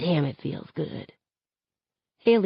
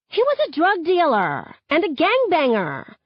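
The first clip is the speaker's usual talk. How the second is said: high-pitched, scornful insistence; heated accusation; 11 semitones higher than usual. A narrator's reading. Haley justifying the killing to her friends, heated accusation.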